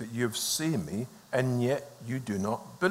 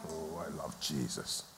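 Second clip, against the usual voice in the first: much lower and softer, slow; tender aside; 9 semitones lower, 13 dB quieter than usual. Real, not acted. Preacher murmuring his love for Jesus to the congregation, softly. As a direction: murmur it with devotion.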